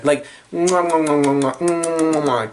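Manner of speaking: string of smacking air kisses; chef's kiss mwahs. Real, not acted. A fan blowing kisses to Maya Angelou, smacking mwahs. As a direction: blow kisses of admiration.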